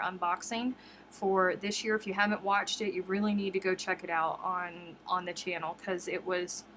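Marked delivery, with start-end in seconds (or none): none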